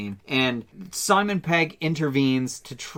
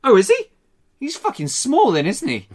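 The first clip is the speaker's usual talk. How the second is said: higher, louder cheeky Cockney retort; Cockney wisecrack, cocky and sharp; Cockney accent; 9 semitones higher, 5 dB louder than usual. Retold or imitated. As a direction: sneer it in full Cockney. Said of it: "full Cockney with his accent".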